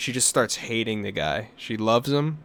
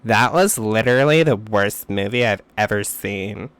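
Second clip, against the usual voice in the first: mock snooty girl; 6 dB louder than usual. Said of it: "she goes".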